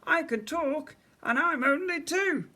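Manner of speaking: very high, squeaky teddy voice; Freddy. A narrator's reading. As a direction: squeak it like a tiny talking toy. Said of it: "He held up his teddy and squeaked".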